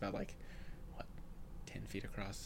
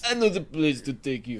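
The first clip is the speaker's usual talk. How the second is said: put-on slow, louder Russian accent; hammed-up Russian cabbie; 2 semitones higher, 5 dB louder than usual. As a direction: draw it out like a Russian driver. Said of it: "He's turned a little Russian".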